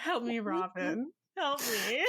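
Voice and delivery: breaking up with laughter; laughing helplessly. Real, not acted. Giggling fit over a hard word.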